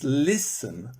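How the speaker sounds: slow LIS-ten, weight on LIS; listen with a strong LIS, weak ending; stress on first syllable of listen. Shown as a demonstration. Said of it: "stress is on the beginning".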